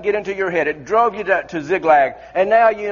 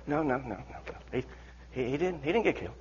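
soft, low, hesitant; hushed reply; 6 semitones lower, 15 dB quieter than usual. Acted. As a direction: answer under your breath. Soldier answering softly.

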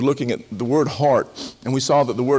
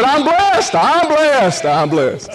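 high, loud chirpy reply, repeated; mock cheerful "blessed"; 12 semitones higher, 6 dB louder, much slower than usual. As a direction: play the smiling believer hiding trouble. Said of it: "How you doing?".

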